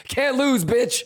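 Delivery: loud, high victory gloating; hyped shout. Real, not acted. Trader crowing to chat about a winning trade, loud and high. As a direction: crow over the winning trade.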